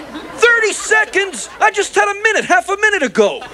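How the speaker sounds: high, outraged objection; incredulous protest. Acted. Alf disputes the thirty seconds left, incredulous.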